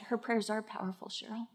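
soft, fast inner voice of the Lord; plain. Retold or imitated. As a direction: say it hushed, like a quiet inner word. The Lord speaks inwardly.

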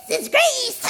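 high, loud frantic cry; manic shout. Retold or imitated. Wife out of her mind, shouting at her family. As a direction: shriek it out of your mind.

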